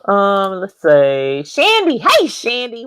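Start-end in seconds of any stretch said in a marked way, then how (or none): 1.4-2.8 high and loud, calling the name; light up at seeing her; excited greeting. Real, not acted.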